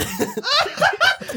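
a sudden high squeal of laughter; high burst of laughter. Real, not acted. Host cracks up at his own accent, high squealing laugh. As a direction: break into a high laughing fit.

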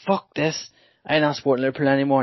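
a child quitting his team, mildly hammed-up anger; retold fuming kid. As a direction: throw the toys out, half-acting the tantrum. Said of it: "I was fuming".

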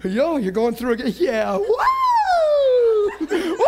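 mock thrill-seeker calling out; excited ride whoops. Pastor acting friends in the ride line, whooping excitedly. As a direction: whoop like you're back on the coaster. Acted friends at amusement park.

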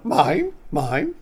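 squawky Finding Nemo seagull; screechy seagull voice. Retold or imitated. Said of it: "give me your best Finding Nemo seagull impression".

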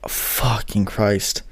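low, slow, soft groan of realization; muttered dismay. Retold or imitated. Producer sees the student's project, slow muttered dismay. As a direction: drag it out under your breath. Seeing the pro's project open.

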